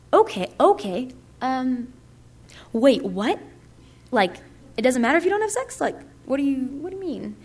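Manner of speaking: replaying her puzzled reaction; baffled. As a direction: act thrown by what you just heard. Retelling her confusion at the speaker.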